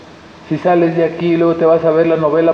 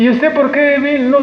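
mock greeter; 8 semitones higher than usual. Voices a church greeter.

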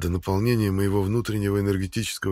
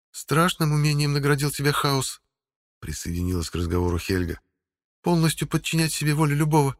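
higher, stern warrior woman; female voice; 7 semitones higher than usual. A narrator's reading. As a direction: speak as a grim woman. Helga joins the talk.

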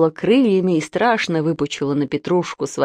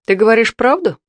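stern question; 4 semitones higher, 4 dB louder than usual. A narrator's reading. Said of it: "строго спросила тётка".

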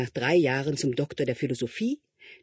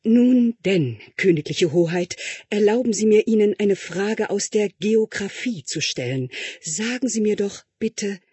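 higher and louder, courtly questioning; fawning tutor; 6 semitones higher, 4 dB louder, slower than usual. Acted. Tutor posing a geography question to the prince, higher and louder. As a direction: ask with exaggerated deference.

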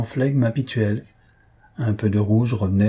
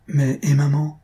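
ordinary delivery, nothing marked

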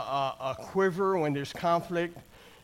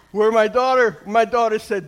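usual speaking voice

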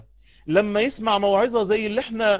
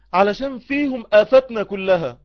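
ordinary talk

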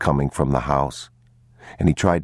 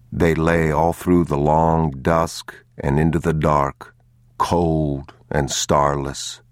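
plain reading voice

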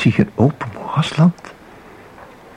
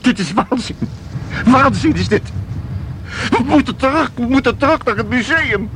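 higher, rising alarm; frightened, pleading to go back; panicked; 7 semitones higher than usual. Acted. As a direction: protest in rising fear, wanting out. Visitor panics inside painting.

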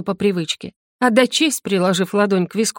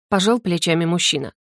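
usual speaking voice

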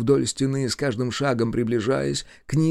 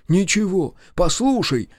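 high, breaking-down plea; beg, unable to hold out; urgent, raised-pitch entreaty; imploring; 7 semitones higher, 4 dB louder than usual. A narrator's reading. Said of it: "не выдержав, взмолился он".

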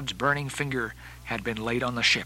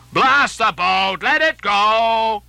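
loud, drawn-out curse; slow, high shouted oath; angry cry; 7 semitones higher, 7 dB louder, much slower than usual. Acted. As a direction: bellow it over a heaving sea. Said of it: "cried Stubb at this instant".

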